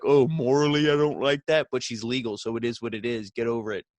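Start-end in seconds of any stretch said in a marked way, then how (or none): 0.0-1.7 higher and louder, hammed-up moral disapproval; mock prudish objection. Telling internet fans how they could object, mock prudish. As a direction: play the scandalised prude, half-joking. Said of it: "you can maybe be like".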